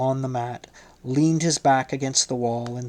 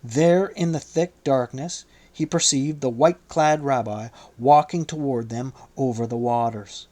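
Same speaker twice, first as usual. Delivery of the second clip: plain reading voice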